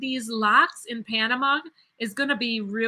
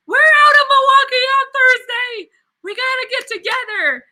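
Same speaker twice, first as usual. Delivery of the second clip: high, loud excited exclaim; 12 semitones higher, 9 dB louder than usual. Real, not acted. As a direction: blurt it out thrilled. Excited to share same flight.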